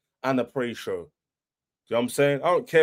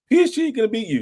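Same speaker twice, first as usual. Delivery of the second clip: higher, whiny mock rival fan voice; mocking the haters; 9 semitones higher, faster than usual. Fan mimicking rival haters with a whiny mock voice. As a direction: sneer their words back in a silly voice. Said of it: "When everyone was like".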